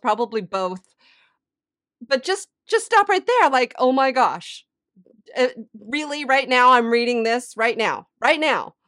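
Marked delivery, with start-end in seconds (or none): 2.0-8.7 higher, louder, incredulous outburst; amazed disbelief. Retold or imitated. Woman astonished by the devotional's timing, raised incredulous voice. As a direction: exclaim it as if stopped in your tracks. Retells amazement at what she read.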